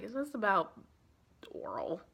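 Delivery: a secret, half-embarrassed whisper; whispered aside. Real, not acted. Narrator hinting at sex in the novel, whispered. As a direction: breathe the word out, no voice.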